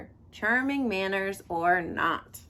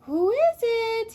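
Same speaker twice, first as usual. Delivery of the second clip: put-on high, slow sugary old-lady voice; the wolf coos like a granny; sweetest granny voice; 13 semitones higher, 5 dB louder, slower than usual. A narrator's reading. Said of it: "in his sweetest granny voice".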